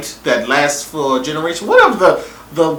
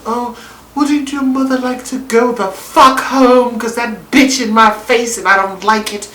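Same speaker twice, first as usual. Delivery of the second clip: higher, catty and scheming; sassy girl voice; 8 semitones higher, 4 dB louder than usual. Retold or imitated. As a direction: play a sly, sassy bride. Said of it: "she's like".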